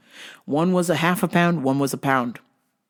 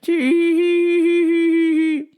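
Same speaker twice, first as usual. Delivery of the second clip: high singsong tee-hee; sing-song giggle; 12 semitones higher, 3 dB louder than usual. Retold or imitated. Worker mimicking customer's sing-song giggle. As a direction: trill a silly giggle up high.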